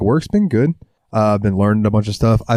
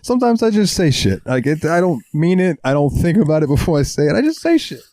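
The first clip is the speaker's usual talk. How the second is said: much higher offhand shrug voice; buddy Wes; 7 semitones higher than usual. Retold or imitated. Quoting a friend's excuse in a high mock voice. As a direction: toss it off like a careless buddy. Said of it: "my buddy Wes used to say".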